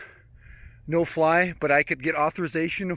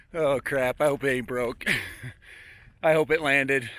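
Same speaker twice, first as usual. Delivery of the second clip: normal talking voice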